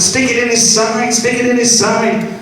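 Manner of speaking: high, pressing repeated order; urgent. Retold or imitated. Teacher urges the other with the pen, high urgent. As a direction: hurry him along.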